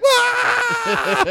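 high, loud scream of terror; panicked scream. Retold or imitated. Comic surrounded in Boston, panicked scream. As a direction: shriek in mock panic. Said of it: "I'm like".

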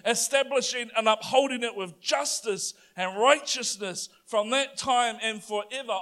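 triumphant preacher in full flow; full-force preaching. Acted. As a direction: proclaim it to the congregation.

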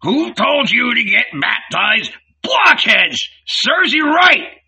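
high, loud jeering shout; scornful yell. A narrator's reading. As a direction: bellow the insults at them. Innkeeper yells back.

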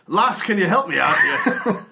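calling out. Acted. Character calling to gnome.

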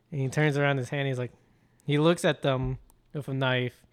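usual speaking voice

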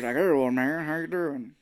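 lightly voiced gruff old man; toned-down drawl of an old Santa; a hint of an old man voice; realistic M. Emmett Walsh. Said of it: "Be more realistic how he actually talks".

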